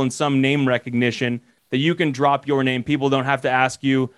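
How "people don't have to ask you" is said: ordinary talk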